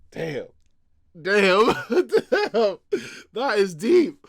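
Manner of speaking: high, very loud, laughing disbelief; amazed outburst. Real, not acted. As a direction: shout it out, bowled over and laughing. Amazed reaction to chapter callback.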